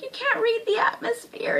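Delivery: high-pitched retold outburst; mock indignation. Fan acting the girl told about chips, high mock-angry complaint. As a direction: imitate a miffed anime girl, pitched high. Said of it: "she gets pissed".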